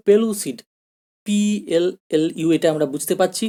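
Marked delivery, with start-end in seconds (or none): 0.1-0.6 পেলুসিড said as pe-lu-sid, pitched up then falling; pronunciation demo. Shown as a demonstration. Teacher answering how it is pronounced, পেলুসিড modelled clearly as pe-lu-sid. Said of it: "তাহলে উচ্চারণটা কী হবে?".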